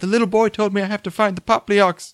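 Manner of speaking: lightly put-on British accent. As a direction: imitate a dreamy girlfriend's British accent. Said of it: "and she's like".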